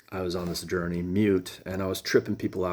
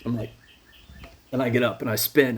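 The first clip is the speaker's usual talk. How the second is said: usual speaking voice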